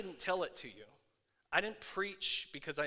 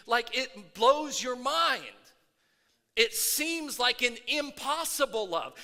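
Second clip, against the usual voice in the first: louder, rising preacher's fervor; preaching at full force; 6 semitones higher, 7 dB louder than usual. Acted. Pastor firing up his church, preaching at full force. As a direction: stir the congregation up, preaching hard.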